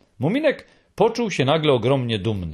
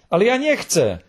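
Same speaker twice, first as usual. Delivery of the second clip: plaintive; 6 semitones higher than usual. A narrator's reading. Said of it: "zaczął Muminek żałośnie".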